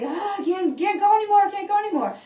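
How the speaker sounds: strained, high, breathless plea; exhausted gasp. Retold or imitated. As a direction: act out giving up mid-sprint. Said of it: "you're gonna feel like".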